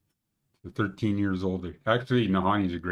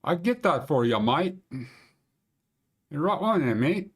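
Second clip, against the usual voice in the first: higher-pitched put-on Aussie accent; Australian accent; 6 semitones higher than usual. Shown as a demonstration. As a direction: do a jokey Australian girl's accent, lifting "mate" at the end. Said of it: "that's my Banff accent".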